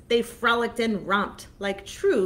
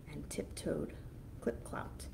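hushed tiptoe whisper; 4 semitones lower, 13 dB quieter than usual. A narrator's reading. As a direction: breathe it out like sneaking. Quiet tiptoeing narration.

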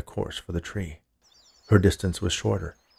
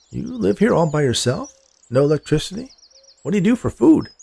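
higher, lilting questions of mild disbelief; affable stranger, surprised; 7 semitones higher than usual. Acted. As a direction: question her in a raised, wondering voice. Man questions her, incredulous.

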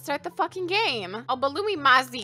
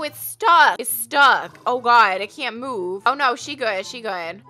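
ordinary delivery

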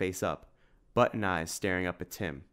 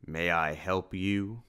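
slow, wary shopkeeper's question; slow wary greeting from a suspicious shopkeeper; slower than usual. Acted. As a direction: ask it guarded and slow, eyeing a stranger. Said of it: "asked a suspicious voice".

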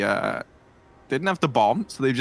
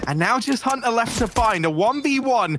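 higher, tense clutch call; excited play-by-play; 5 semitones higher than usual. Real, not acted. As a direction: build tension through the clutch.

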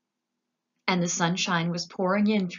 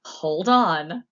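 called out louder in alarm; a quick cry to brace everyone; warning cry; cried out; 8 dB louder than usual. A narrator's reading. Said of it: "cried Mister Wonka".

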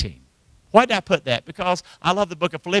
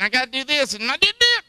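high, frazzled imitation of a fretting person; lightly hammed-up anxious chatter; mock busy worrier; 10 semitones higher than usual. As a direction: mimic someone fussing over chores. Mimics an earthly-minded busy person.

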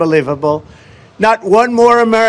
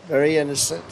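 much softer, dropped low and knowing; soft ironic repeat; quiet aside; 3 semitones lower, 9 dB quieter than usual. Real, not acted. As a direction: mutter it to yourself with irony.

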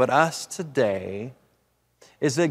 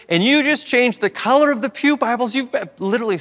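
louder, much higher outraged accusation; mock-outraged parishioner; indignant complaint; 8 semitones higher, 4 dB louder than usual. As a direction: voice a churchgoer's grievance. Quoting an upset churchgoer.

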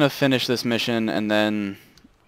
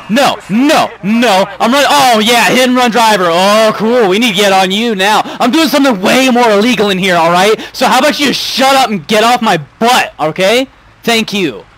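much higher, louder shouted rant; 8 semitones higher, 6 dB louder than usual. Real, not acted. Streamer shouting at a cop on his tail, loud rant. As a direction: rant at the cop at full volume.

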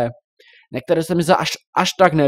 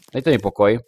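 ordinary delivery

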